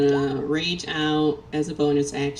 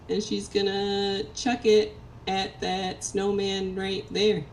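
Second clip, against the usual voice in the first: ordinary talk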